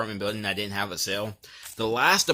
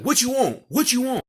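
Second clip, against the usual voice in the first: very high, loud mock demand; exaggerated retold irritation, voice shooting up; angry; 13 semitones higher, 5 dB louder than usual. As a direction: imitate a furious customer confronting you at the door. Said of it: "he was angry, he was mad".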